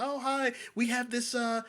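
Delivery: imitating an overly sweet waitress; mock sweet server. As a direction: parody a syrupy southern waitress offering food.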